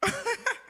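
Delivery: short high burst of laughter; real amused laugh. Real, not acted. Host laughs at contestant.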